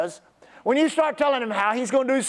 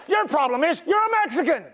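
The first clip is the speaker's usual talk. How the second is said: higher-pitched blunt pronouncement; blunt challenge; 5 semitones higher than usual. Retold or imitated. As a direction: retell it as a bold challenge. Retells his blunt challenge to pastors.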